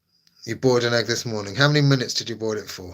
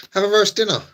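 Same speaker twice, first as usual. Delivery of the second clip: emphatic urging; 10 semitones higher, 5 dB louder than usual. Real, not acted. Emphatic food advice.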